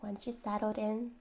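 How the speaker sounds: unhurried relaxation guidance with long pauses; guided meditation. Real, not acted.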